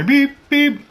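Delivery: high beep-beep of a book scanner; imitated scanner beep. Scanner at library beeps.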